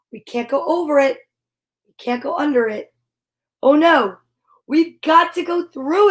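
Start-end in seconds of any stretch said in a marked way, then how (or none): none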